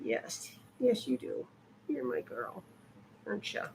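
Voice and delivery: soft whispered words to the dog. Real, not acted. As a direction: breathe it close and secret.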